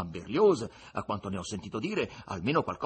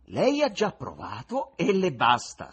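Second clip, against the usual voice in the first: slow, higher, louder wry jab; ironic; 8 semitones higher, 9 dB louder than usual. Acted. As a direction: say it with a knowing irony. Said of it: "replicò ironico l'ospite".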